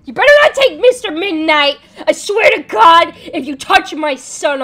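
loud, heated warning; loud warning to protect the cat; fierce protective threat. Real, not acted. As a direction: warn them off loudly.

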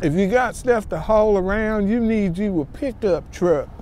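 slow, drawn-out Southern US drawl; southern accent. Pretend. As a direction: put on a playful Southern accent, stretching the words. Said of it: "That was my southern accent".

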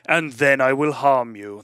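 put-on English lord accent. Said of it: "I can do an English accent".

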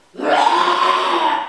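loud breathy mock roar; cow roar. Cow pretending to be a lion, loud mock roar. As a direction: roar it to scare the sheep. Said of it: "brult de koe heel, heel hard".

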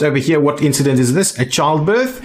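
normal talking voice

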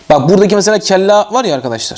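usual speaking voice

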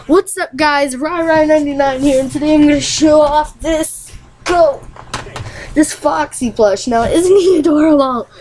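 put-on perky kid host showing off a toy; Rai Rai 99 impression. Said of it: "Okay, Rai Rai ninety-nine".

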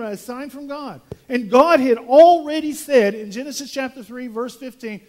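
loud, pressing pulpit voice; fiery preaching. Acted. Pastor challenging the crowd's demand for signs, preaching loudly. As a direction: pound out each miracle as proof.

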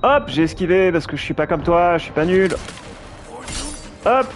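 mock the enemy while dodging; berserk rage. Real, not acted. Taunting boss during fight. (provocation enragée)

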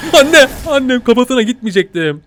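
high, loud panicked cry into a laugh; hammed-up shriek then laughter; mock panic with laugh. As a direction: squeal in fake fright.

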